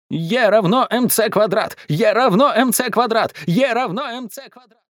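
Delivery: high, repetitive chant-like cry; crying out gibberish. A narrator's reading. Mum bouncing on the sofa cries out nonsense, frenzied. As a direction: keep shouting the formula high, round and round. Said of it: "кричала что-то совершенно непонятное".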